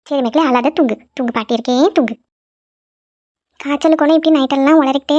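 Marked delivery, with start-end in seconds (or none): 0.1-2.2 put-on rock the child to sleep with your voice; lulling. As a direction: grandmother hushing the girl to sleep. Grandmother lulls the girl to sleep.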